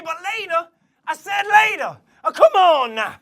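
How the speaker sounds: rising impatient cowboy shout. Acted. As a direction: yell it off to the side, fed up. Shouting at an interruption.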